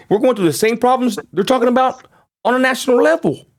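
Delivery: high-pitched, exasperated outburst; worked-up indignation. Real, not acted. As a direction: voice jumps way up, incredulous and emphatic. Indignant at same fight locally.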